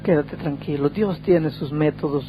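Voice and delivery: soft, soothing mother's words; hushed, tender consolation; gentle reassurance. Retold or imitated. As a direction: comfort a distressed son quietly, taking your time. Mother comforts her crying son.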